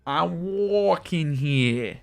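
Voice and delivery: put-on a lighter retry of the New York accent; weaker New York accent. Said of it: "Nah, that's not that good".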